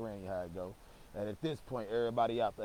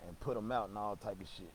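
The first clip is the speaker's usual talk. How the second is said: normal talking voice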